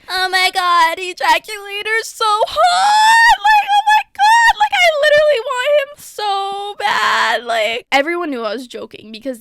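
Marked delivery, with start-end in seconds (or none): 0.1-7.4 high, loud, hammed-up fangirl shriek; mock crush gushing. Mocking the boy who insulted her in front of everyone, gushing mock shout. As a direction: scream it like a crazed fan, joking. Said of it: "go crazy and I'm like".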